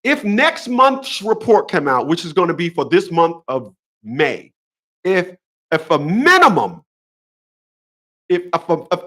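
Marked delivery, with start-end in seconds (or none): none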